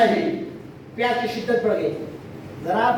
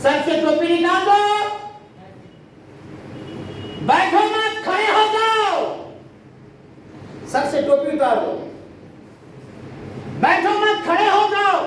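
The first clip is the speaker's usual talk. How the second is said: commanding call; 8 semitones higher than usual. Acted. Preacher orders how to drink Zamzam.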